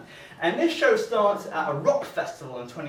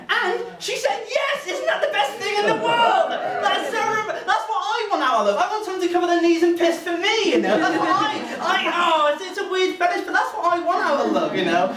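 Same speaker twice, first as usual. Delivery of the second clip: gushing delight; 11 semitones higher, 4 dB louder than usual. Real, not acted. Comic gushing over proposal.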